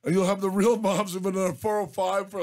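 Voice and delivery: ordinary delivery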